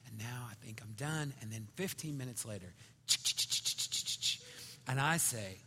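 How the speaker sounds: normal talking voice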